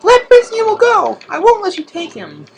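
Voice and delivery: high squeaky falsetto heroine; hammed-up high girly defiance; falsetto girl voice. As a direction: pitch it way up like a cartoon girl. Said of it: "It's like".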